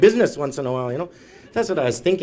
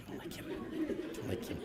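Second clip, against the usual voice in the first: very soft aside; barely voiced aside; mock whisper; 13 semitones higher, 17 dB quieter, much faster than usual. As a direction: murmur it as a hidden onlooker. Whispered audience voice.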